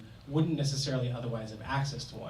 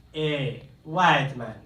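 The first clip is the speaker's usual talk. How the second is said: plain call-out; 3 dB louder, much slower than usual. Retold or imitated. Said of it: "heard somebody call out".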